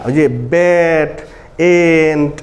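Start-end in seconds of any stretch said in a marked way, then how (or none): 0.5-1.1 "ব্যাট" with a long open "ae"; wide drawn-out vowel. Shown as a demonstration. Teacher contrasting A with short E, long open "ব্যাট". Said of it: "যেভাবে বলছি এরকম না". 1.7-2.3 "এন্ট" with a long open "ae"; wide drawn-out vowel. Shown as a demonstration. Teacher showing pupils what E is not, stretched "এন্ট". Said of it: "যেভাবে বলছি এরকম না".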